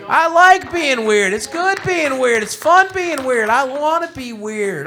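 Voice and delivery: high, loud, slow preacher cadence; mock preaching. Rallying the room of weird gamers, mock sermon. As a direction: preach it to the congregation.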